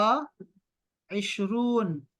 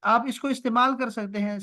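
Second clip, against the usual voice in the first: normal talking voice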